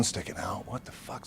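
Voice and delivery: breathless unvoiced whisper. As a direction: whisper it to yourself. Whispered disbelief.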